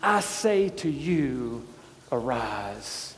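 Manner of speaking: hushed command. Acted. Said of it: "and he looks at all of us and says".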